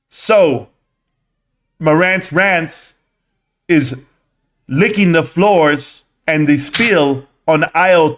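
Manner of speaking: mock the slow speaker's cadence; slow mocking retelling. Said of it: "he said it a lot slower than that".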